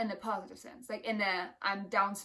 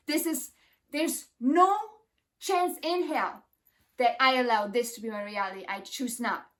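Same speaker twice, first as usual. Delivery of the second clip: loud, rising defiant chant of refusal; forceful declaration; 4 dB louder than usual. Retold or imitated. Telling the darkness loudly she won't allow it, firm and defiant. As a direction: declare it like a vow you won't break. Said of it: "I'm like".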